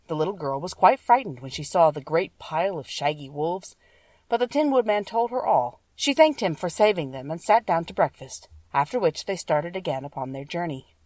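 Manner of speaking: plain reading voice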